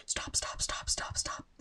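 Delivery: urgent hushed whisper; whisper-yell stop over and over; frantic whisper at two in the morning; whisper screaming. Retold or imitated. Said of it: "I just kept whisper screaming".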